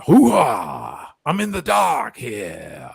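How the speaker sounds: loud, slow Pacino bark; hammed-up Pacino yelling into the dark; Al Pacino impression. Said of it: "he gets all like that".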